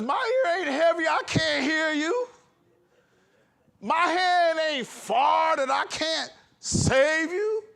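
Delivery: high, breaking voice; choked with tears. Real, not acted. Preacher tearfully voicing God's answer. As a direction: voice cracking with emotion, rising to a cry.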